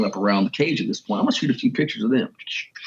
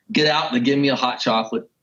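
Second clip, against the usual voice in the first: normal talking voice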